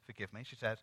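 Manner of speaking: normal talking voice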